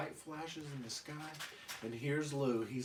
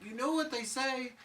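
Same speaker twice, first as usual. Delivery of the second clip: put-on much higher timid baby warning; worried Chucky; 10 semitones higher than usual. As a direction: squeak a nervous warning. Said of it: "Chucky's like".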